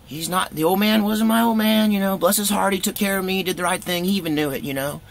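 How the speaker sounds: high, wounded self-talk; resigned inner voice. Retold or imitated. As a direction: think it aloud, hurt but accepting. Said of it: "so I thought".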